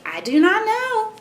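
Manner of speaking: very high, drawn-out storybook reply; expressive read-aloud. Shown as a demonstration. Mother models story reply for child, high and slow. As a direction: slide way up and stretch the words. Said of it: "try and match how I say it".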